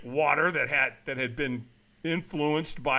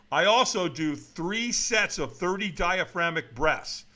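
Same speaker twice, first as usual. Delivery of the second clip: normal talking voice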